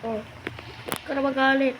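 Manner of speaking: ordinary talk, nothing marked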